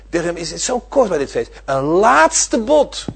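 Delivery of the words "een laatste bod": slow rising. Shown as a demonstration. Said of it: "Een allerlaatste bod".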